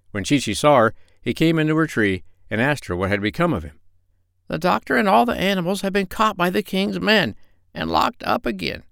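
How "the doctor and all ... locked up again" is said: high parrot voice, hushed; whispered parrot. Acted. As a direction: tell it in a secretive hush. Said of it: "whispered Polynesia".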